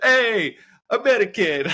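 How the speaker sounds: high, loud teasing hail; mock bakery greeting. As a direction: call out the nickname like a cheeky baker. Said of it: "They'll be".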